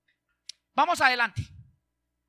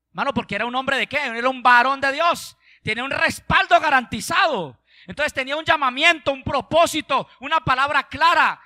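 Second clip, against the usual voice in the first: louder, emphatic pulpit delivery; forceful preaching; 5 semitones higher, 8 dB louder than usual. Real, not acted. Preacher listing a man of God's backing, forceful. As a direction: drive each point home loudly.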